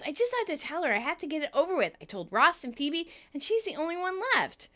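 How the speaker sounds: high, eager rush of news; acted excited confession. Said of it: "Monica excitedly says".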